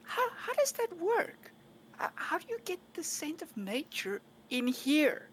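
high, slow, wide-eyed questions; high-pitched amazed curiosity; eager curiosity. Acted. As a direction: gush with fascinated interest at the butler. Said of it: "she'll go".

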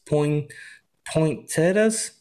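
stumble, then stretch the word out questioningly; slow, unsure attempt at a tricky word; tripping over pronunciation. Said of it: "I was tripping out".

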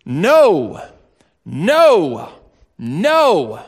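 loud, punched no no no; emphatic no. Retold or imitated. Paul protesting to the Romans, loud and firm. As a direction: bang out each no. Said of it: "he says".

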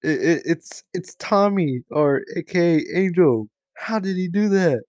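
hammed-up disbelief, pitch jumping up; shocked realization. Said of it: "And you're like".

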